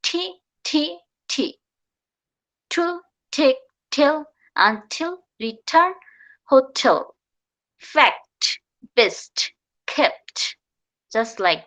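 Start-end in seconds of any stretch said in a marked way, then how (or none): 0.0-1.5 ঠি as a hard aspirated T; stressed true T. Shown as a demonstration. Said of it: "একটা stress দেব". 2.7-7.0 each T in take, till, hotel hard and aspirated; word by word, puff the T in until and return; stressed true T. Shown as a demonstration. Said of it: "একটা stress দেব". 7.9-10.4 fact, best, kept with released final T; stressed true T. Shown as a demonstration. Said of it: "একটা stress দেব".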